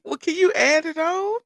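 high, loud, half-laughing ask; desperate high plea. Retold or imitated. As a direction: beg the agent with a squeak. Pleading with the insurance agent.

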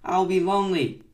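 loud, high plaintive cry; shouted lament. A narrator's reading. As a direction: wail it out to a lost friend. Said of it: "he shouted".

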